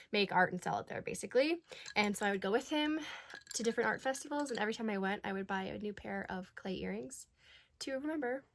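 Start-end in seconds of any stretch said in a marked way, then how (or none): none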